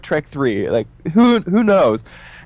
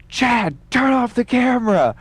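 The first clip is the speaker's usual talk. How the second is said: room yelling; 11 semitones higher than usual. Retold or imitated. Whole room erupts at him.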